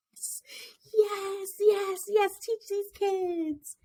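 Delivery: put-on high eager child voice, last words slow; excited inner child. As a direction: squeal yes like a thrilled kid. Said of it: "my inner child is like".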